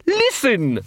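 high, pressing urgency; hissed insistence. A narrator's reading. Captain leaning towards Carrot, hissing insistently. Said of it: "hissed Quirk".

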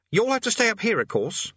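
put-on high teenage boy voice. A narrator's reading. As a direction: play a nervous young boy.

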